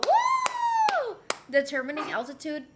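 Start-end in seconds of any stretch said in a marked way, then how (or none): 0.0-1.1 high whooping cheer; thrilled little woo; excited whoop. Real, not acted. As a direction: let out a delighted woo.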